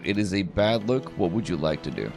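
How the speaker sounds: ordinary talk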